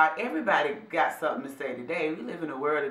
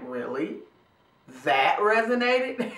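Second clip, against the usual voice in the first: surprised disbelief. Retold or imitated. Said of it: "And I'm like".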